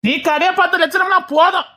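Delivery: high, scornful rebuke; angry scolding. Acted. Father scorning son's offer to mind shop, angry scolding.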